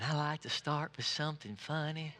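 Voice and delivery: soft, gentle imitation of a smooth TV preacher; Joel Osteen impression. Preacher imitating a famous pastor's opening, soft and mild. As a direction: lightly mimic a mellow, soft-spoken televangelist. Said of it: "you got Joel Osteen".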